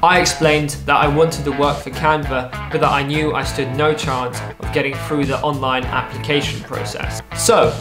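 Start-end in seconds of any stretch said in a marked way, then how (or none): none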